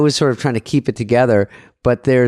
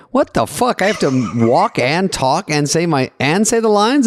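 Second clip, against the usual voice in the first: high-pitched, incredulous protest; mock disbelief; 6 semitones higher than usual. Actor balks at walking while saying lines, mock disbelief. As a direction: act out being thrown by the instructions. Retells panic at getting new blocking.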